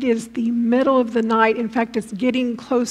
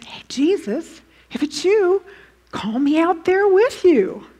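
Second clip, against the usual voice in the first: higher-pitched calling out; light impression, raised in pitch; lightly voiced Peter; 6 semitones higher than usual. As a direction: pipe up with an eager request. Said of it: "and Peter says".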